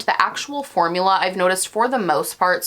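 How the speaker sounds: ordinary talk, nothing marked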